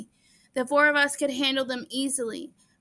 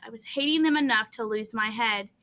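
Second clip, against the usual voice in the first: ordinary delivery, nothing marked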